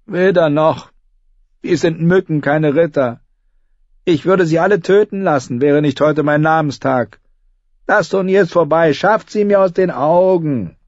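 put-on young king, bored and cruel; cruel boy king. A narrator's reading. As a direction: dismiss them with contempt.

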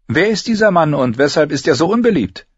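lady calling to her escort; noble lady. A narrator's reading. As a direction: address her companion. Caitlin voiced in the story.

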